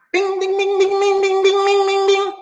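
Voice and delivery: high, monotone ringing bell imitation; ding repeatedly on one high note; imitated bell.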